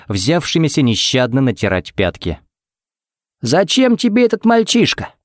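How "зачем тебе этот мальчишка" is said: high-pitched, puzzled question; sincere surprise. A narrator's reading. Man asking why she wants that boy, genuinely surprised. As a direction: ask it in honest bewilderment, pitch lifted. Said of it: "удивился он вполне искренне".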